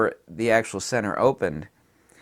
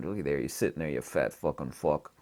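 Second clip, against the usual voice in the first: muttered insult; 8 semitones lower, 9 dB quieter than usual. Retold or imitated. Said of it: "He says".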